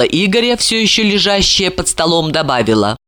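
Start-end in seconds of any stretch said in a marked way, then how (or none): none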